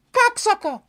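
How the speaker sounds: put-on high, squeaky little-kid voice; imitated small child's high-pitched outburst; young child yelling out. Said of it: "this adorable young child yelled out".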